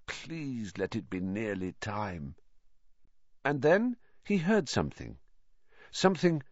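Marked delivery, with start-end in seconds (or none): 0.0-2.3 slow, soft inward plea; miserable. A narrator's reading. Boy silently begging detention to end, miserable. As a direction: plead under your breath. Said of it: "Harry thought miserably".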